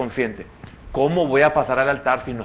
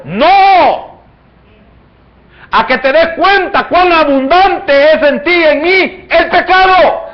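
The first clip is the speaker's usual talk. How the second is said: rising, louder sermon build; preaching at full force; 10 semitones higher, 9 dB louder than usual. Acted. Preacher driving the point home to the congregation, loud and rising.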